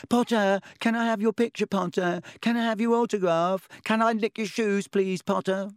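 high-pitched mocking impression of an eager fan; Colin. A narrator's reading. Malfoy mimicking a fan begging Potter, high mocking pleading. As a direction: beg like a starstruck little admirer, cruelly exaggerated. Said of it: "cruel but accurate impression of Colin".

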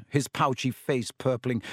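usual speaking voice

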